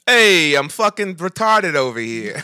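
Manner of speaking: slow, loud, put-on Jersey tough guy; Jersey mobster accent. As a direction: play a brash Jersey mobster.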